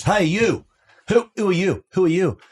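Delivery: high, loud mock challenge; imitating a call-out. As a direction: bark it across the room at a stranger. Gus calling out to manager.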